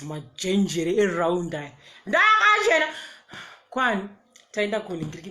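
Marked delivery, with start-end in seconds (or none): 2.1-2.9 proud. Real, not acted. Proud of her outfit.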